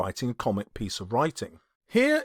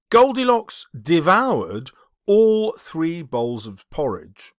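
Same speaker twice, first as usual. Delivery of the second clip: devoured all three bowls, slow and louder; slow, weighted reading; 4 semitones higher, 5 dB louder, slower than usual. Shown as a demonstration. Teacher reading the Goldilocks example, slow and stressed. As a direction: lean on the word all. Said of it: "But look at this".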